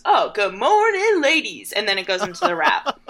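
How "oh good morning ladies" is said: much higher, louder and slow, a hammed-up old man's greeting; blind man joke voice. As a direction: stretch the greeting out high and loud like a joke punchline. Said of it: "and he goes".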